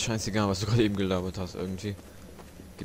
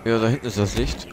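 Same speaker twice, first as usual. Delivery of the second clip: ordinary talk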